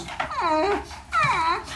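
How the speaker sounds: high whimpering hum; student pleading with puppy dog eyes, high mock whimper; pitiful whimper. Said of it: "and he goes".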